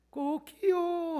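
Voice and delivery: high stretched cock-a-doodle crow; rooster crow. Retold or imitated. Imitating rooster crow at dawn. (높고 길게 늘인 닭 울음 흉내)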